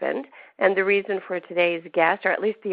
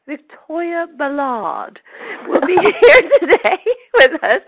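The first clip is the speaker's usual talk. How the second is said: put-on playful English accent, laughing midway; British accent; 9 semitones higher, 8 dB louder, slower than usual. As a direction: announce her name with a British accent. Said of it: "She's from England, so I'm supposed to say".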